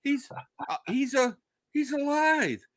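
high, stammering mock-tearful voice; imitated crying. Nurse in the hallway announcing he lives, imitated tearful stammer.

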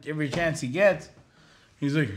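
usual speaking voice

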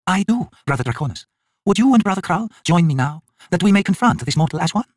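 high, urgent elder sister; goddess voice. Acted. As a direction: raise it high and pressing. The sister replies.

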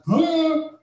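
high, puzzled huh; a high confused huh; baffled huh. Retold or imitated. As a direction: sound totally lost. Listener baffled by klezmer.

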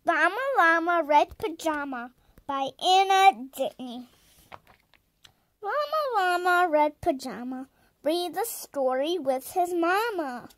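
loud, sing-song storybook reading; a child's eager bedtime-story read-aloud; reading a picture book. Acted. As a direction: read it out proudly to your viewers.

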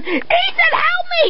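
high shrieking terror; panicked scream. Acted. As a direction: scream it, trapped and scared. Girl screams for help.

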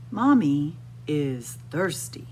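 ordinary delivery

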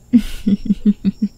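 breathy whispered giggle. Acted. Creepy laugh inside the whispering.

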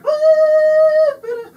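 very high, very loud, slow cry; cried out. A narrator's reading. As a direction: shriek it in delight. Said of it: "she cried".

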